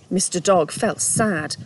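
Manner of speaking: usual speaking voice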